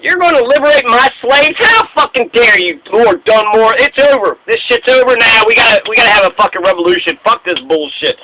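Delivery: high, indignant mock rant; mock outrage. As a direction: Washington outraged his slaves may be freed, hammed-up. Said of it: "So George Washington's like".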